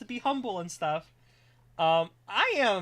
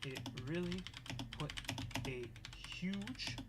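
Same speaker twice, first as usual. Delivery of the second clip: very soft, halting dictation; composing aloud; 4 semitones lower, 14 dB quieter than usual. Real, not acted. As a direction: murmur word by word while writing. Composes thank-you message aloud.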